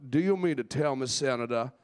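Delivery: put-on genteel Southern accent, hemming first; Southern senator. Senator questioning another in a hearing, Southern accent. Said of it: "Southern to me is like a senator".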